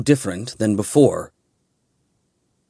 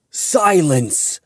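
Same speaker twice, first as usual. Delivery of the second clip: a higher, sharp cry of silence; roared command; 6 semitones higher than usual. A narrator's reading. Jin silencing the angel, roaring. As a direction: cut the angel off hard. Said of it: "Jin roared".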